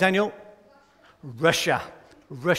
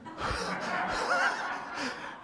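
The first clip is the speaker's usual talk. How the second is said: high breathy laugh; 7 dB quieter than usual. Real, not acted. Laughing at his own attempt.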